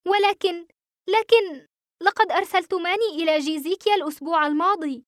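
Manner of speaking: hesitant, disbelieving objection; faltering protest. A narrator's reading. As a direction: object haltingly, confused. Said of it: "غمغمت قائلًا".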